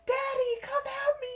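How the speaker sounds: mock crying shout for daddy, very high; hammed-up kid crying for daddy, high and loud; crying yell. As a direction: wail for daddy like a little kid. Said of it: "crying, yelling".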